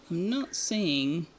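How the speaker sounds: ordinary talk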